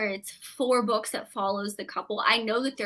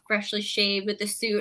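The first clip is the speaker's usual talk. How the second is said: ordinary delivery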